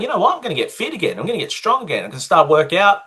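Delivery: pumped up, raising the pitch high; a burst of retold enthusiasm, pitched well up; excited resolve. Retold resolve to get fit.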